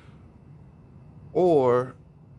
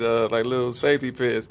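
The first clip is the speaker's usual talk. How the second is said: usual speaking voice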